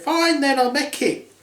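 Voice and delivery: high huffy princess; high girly mock-huffy. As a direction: toss it off high and put out.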